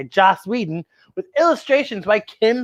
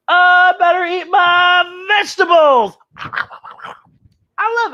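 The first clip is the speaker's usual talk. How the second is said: slow, higher, loud put-on kid voice; mock kid voice; 10 semitones higher, 5 dB louder, much slower than usual. Host playing a kid who must eat vegetables, slow and high. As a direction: ham it up like a fussy kid.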